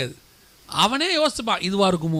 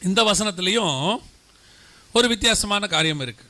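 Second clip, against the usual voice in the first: normal talking voice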